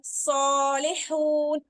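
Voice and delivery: सॉलिहून drawn slowly with heavy सॉ; slow bold reading. Shown as a demonstration. Teacher reading सॉलिहून from the Quran, slow. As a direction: stretch it, heavy first syllable. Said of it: "हम read करते हैं ना".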